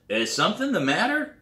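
a lightly voiced puzzled stranger. Stranger questions the visitor.

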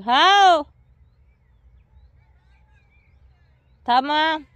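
loud, high call across a distance; high and loud hollering reply. Real, not acted. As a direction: shout out to someone far away. Calling out across the yard.

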